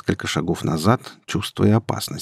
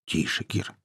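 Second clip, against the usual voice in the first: much softer, calming warning; hushing; 10 dB quieter than usual. A narrator's reading. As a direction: rein her in under your voice. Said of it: "осадил я девушку".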